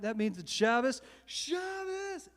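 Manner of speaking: rallying call. Retold or imitated. Singer hyping a packed bar, rising high call. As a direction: build to a high crowd-rousing call.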